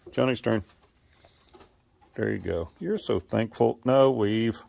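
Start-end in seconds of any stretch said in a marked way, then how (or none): none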